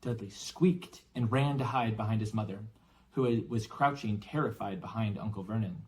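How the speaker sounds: ordinary delivery, nothing marked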